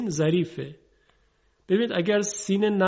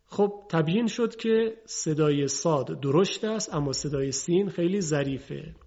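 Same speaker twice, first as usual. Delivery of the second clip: ordinary delivery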